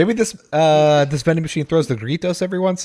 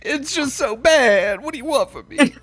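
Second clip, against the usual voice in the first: whiny mock voice actor; 9 semitones higher than usual. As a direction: whine like a stung actor. Mock dub-actor defense.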